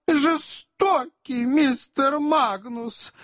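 very high, slow wail of reproach; wounded lady, theatrically drawn out; tearful exclamation. A narrator's reading. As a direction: cry out as if about to burst into tears. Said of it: "воскликнула леди средних лет, после чего залилась слезами".

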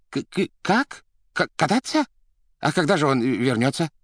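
high, stuttering disbelief; stammering bewilderment. A narrator's reading. Administrator hearing of the car trip, stammering in surprise. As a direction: stumble over the words, taken aback.

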